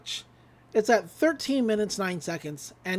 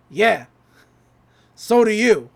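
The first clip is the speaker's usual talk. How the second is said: louder, punchy retort; loud comeback; 7 dB louder, slower than usual. Retold or imitated. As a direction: fire the line back at him. Stan's comeback to the agent.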